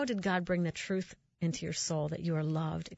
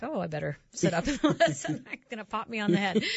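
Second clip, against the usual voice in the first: lightly voiced startled teen, laughing; teen boy voice; 3 semitones higher, 4 dB louder than usual. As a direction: play the boy, half laughing. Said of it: "so it's almost like the boy's like".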